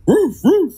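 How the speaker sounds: dog barking. Retold or imitated. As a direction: bark it out like a small yapping dog. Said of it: "He was like".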